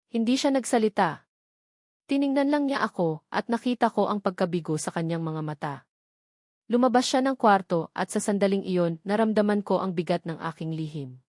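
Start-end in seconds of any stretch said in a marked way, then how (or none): none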